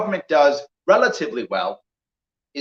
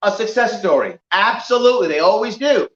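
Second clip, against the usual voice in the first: ordinary talk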